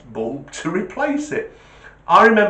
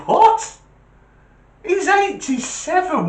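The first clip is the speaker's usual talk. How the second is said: high, loud disbelieving yelp; can't believe what he's hearing; shocked; 4 dB louder, slower than usual. Retold or imitated. Son reacts to carer giving Viagra, shocked and high.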